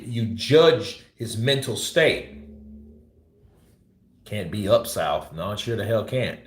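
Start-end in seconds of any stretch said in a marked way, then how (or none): none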